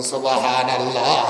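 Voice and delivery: long-held sermon exclamation; drawn-out praise. Real, not acted. Preacher leading the crowd in praise, drawn out. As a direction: stretch the last syllable out for the congregation.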